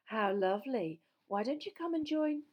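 high, gushing invitation; beaming invitation. A narrator's reading. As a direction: invite with a big smile in the voice.

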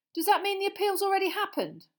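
put-on high, loud urgent boy; Ron voice. Boy interrupting the minister, anxious and urgent. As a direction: blurt it out anxiously.

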